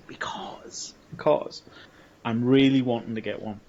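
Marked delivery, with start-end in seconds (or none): 0.1-1.6 whispering. Real, not acted. Whispered aside mid-intro.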